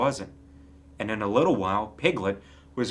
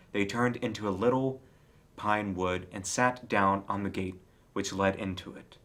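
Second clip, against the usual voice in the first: plain reading voice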